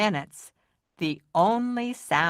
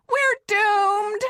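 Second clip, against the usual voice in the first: much higher panicked yelp; terrified scream; 4 dB louder than usual. Acted. Mitzi screaming at the shape, terrified. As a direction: shriek in mock doom. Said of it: "Mitzi screamed".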